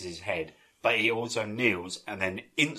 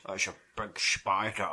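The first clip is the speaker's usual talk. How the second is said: bad Connery impression; 7 dB quieter than usual. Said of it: "the worst impression of all time".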